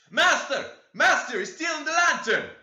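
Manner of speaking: very high, very loud alarm cry; shrieking old servant raising the alarm; old man's shout. A narrator's reading. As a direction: yell for the master in alarm. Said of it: "shouted the ancient".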